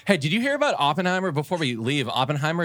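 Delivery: high, fast eager blurt; excited. Real, not acted. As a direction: burst out with the news. Eager news burst.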